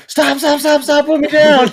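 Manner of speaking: high, loud mock pleading; panicking tiny mouse. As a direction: yelp it as a grabbed little mouse. Acted mouse protesting.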